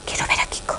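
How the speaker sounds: weak, breathy whisper; whispered plea. Acted. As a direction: plead under your breath to see your child. Repeats request, worn down.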